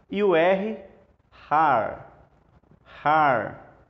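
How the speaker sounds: "har" with a throaty h, said carefully; careful teacher reading of "har"; reading the word out. Shown as a demonstration. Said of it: "vamos ler essa palavra aqui".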